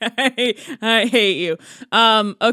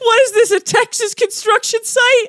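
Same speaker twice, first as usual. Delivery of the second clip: high, raised incredulous protest; mock outrage; 11 semitones higher, 3 dB louder than usual. Real, not acted. Co-host denied water until the podcast ends, mock outrage. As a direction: cry out indignantly with a laughing edge.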